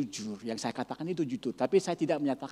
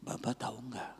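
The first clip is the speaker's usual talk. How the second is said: soft hushed question, much softer; secretive whisper; 2 semitones lower, 8 dB quieter than usual. Retold or imitated. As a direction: ask it like sharing a secret. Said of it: "suara ibu ini berubah".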